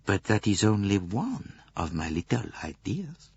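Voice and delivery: plain reading voice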